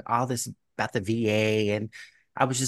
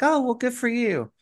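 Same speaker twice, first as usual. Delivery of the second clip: high-pitched polite reply; awkwardly polite; 12 semitones higher than usual. Retold or imitated. Answering the man who says he likes it, awkwardly polite. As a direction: go high to re-enact awkward encouragement.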